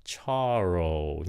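Charles with an "aw" vowel, ending low; falling "chor". Shown as a demonstration. Teacher shows learners the vowel in Charles. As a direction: drop the pitch on Charles. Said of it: "âm ồ hay là âm rồ xuống giọng".

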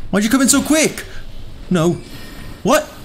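high-pitched alarmed complaint; real flustered yelp; startled protest. Real, not acted. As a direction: squawk it in shock. Startled by enemy.

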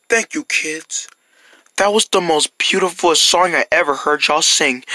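put-on low, gruff old neighbour voice; a softened grump thanking the kids; grumpy neighbour.

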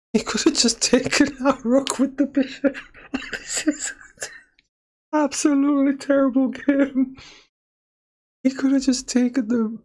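high-pitched laughter through the words; giggling helplessly at a bad move; laughing. Real, not acted. Reviewing his own chess game, laughing high and loud. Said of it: "but I am laughing 'cause that was so awful".

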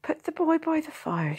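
motherly village wife. A narrator's reading. Blacksmith's wife voiced.

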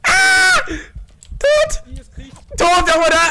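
high startled yell; startled shouting. Real, not acted. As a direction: shout in shock. Teammate killed by ghost.

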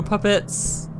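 usual speaking voice

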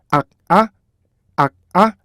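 "악, 아" split in two by a K stop; K 스톱으로 분리. Shown as a demonstration. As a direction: cut off "악" with a K stop, then release "아". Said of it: "이 사이에 K를 넣어주셔야 돼요". ("악, 아" 사이를 K로 끊어 두 음절로)

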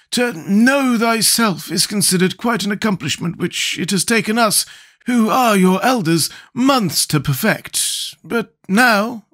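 high, slow character voice; Scarecrow's voice. A narrator's reading. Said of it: "remarked the Scarecrow".

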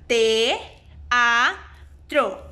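"te, a, tro" split into separate beats; syllable by syllable. Shown as a demonstration. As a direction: break "teatro" into three spaced syllables. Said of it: "tiene tres sílabas". (separando sílabas)

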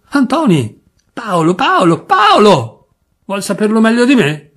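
put-on high, loud Turin-accent outburst, peaking on the last Paolo; flustered Turin cousin. Drunk cousin conceding the name, loud Turin accent. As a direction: snap back the name, flustered, in Piedmontese.

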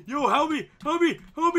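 high, loud desperate pleas; panicked cry for help. Real, not acted. Cornered by creepers in game.

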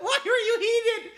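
high, laughing through the question. Real, not acted. Streamer laughing at an appeal, high and giggly. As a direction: crack up while asking.

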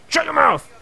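high, loud hammed-up yell; mock shout. Gamer yelling at an in-game snitch, mock shout. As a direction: bark it, half laughing.

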